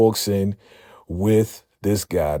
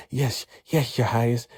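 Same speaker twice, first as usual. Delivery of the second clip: high, sarcastic servile voice; bow and scrape with sarcasm; mock servile; 7 semitones higher than usual. [name] mockingly obeying the demanding captor, high and servile.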